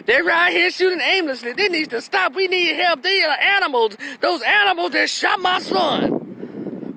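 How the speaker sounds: very high, mock anguished outcry; imitated distraught mother. Mother on TV decrying her son's shooters, hammed-up anguish. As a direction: plead for help in a high put-on voice, dragging the last words.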